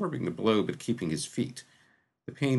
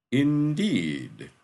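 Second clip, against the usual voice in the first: slow, ominous, ringing confirmation; a dark lord lightly voiced, confirming his name; voice like doom; 5 semitones higher, 4 dB louder than usual. A narrator's reading. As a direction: let it fall like a verdict of doom. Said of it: "said the voice like doom".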